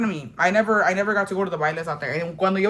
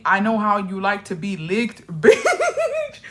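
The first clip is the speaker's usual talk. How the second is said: cracking up mid-quote; laughing; 2 semitones higher, 3 dB louder, slower than usual. Real, not acted. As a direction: laugh through the line. Retelling vampire line, laughing.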